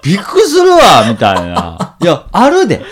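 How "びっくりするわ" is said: loud, high shocked exclamation; shocked cry. Retold or imitated. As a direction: burst out in mock astonishment. Retold shocked reaction quote. (驚いて大声で叫ぶ)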